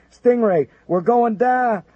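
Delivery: high, loud panicked mayday; high-pitched shout. Acted. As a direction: shriek it while going down. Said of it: "shouted a high-pitched voice".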